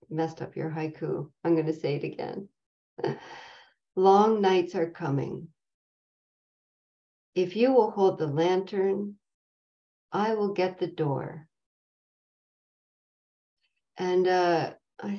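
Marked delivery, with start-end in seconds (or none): 4.0-11.5 poem recitation. Acted. Recites the haiku again.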